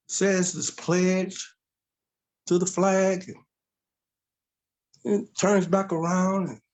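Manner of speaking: usual speaking voice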